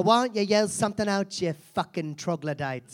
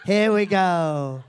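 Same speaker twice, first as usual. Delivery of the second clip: slow, drawn-out here we go; 5 dB louder, much slower than usual. Shown as a demonstration. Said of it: "repeated catchphrase takes".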